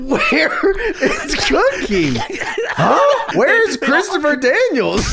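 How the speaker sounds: high, laughing hammed-up calls; mock calling out. Co-host riffing on the Cookie search, mock calling out. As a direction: call for missing people in pretend alarm, laughing.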